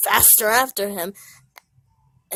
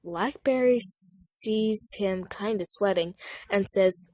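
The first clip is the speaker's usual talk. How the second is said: ordinary delivery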